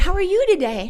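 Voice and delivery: high, loud, cheery greeting; bright greeting. Retold or imitated. Trying to cheer up a crabby woman at church, high and cheery. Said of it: "and I said".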